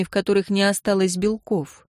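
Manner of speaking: plain reading voice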